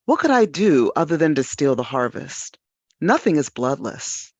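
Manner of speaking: ordinary talk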